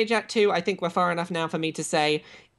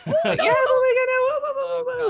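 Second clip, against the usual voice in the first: high squeaky laughing panic; mock flustered alien; 5 dB louder than usual. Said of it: "It's like".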